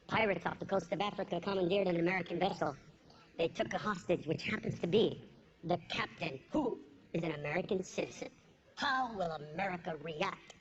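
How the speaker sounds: low, grave newscaster voice; mock-solemn news bulletin; news read. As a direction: read it like a serious evening anchor. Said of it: "what did they do in the news".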